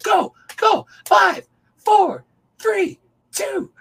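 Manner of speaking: put-on very high, loud child's countdown cheer; kid cheering. Boy cheering the player at the arcade, very high and loud. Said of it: "He's like".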